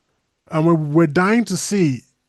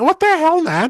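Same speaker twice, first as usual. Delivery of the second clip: high, outraged "what the hell"; indignant alien; 11 semitones higher than usual. Retold or imitated. Moon aliens just shot at, high outraged disbelief. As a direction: squawk in high offended disbelief. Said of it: "And they're like".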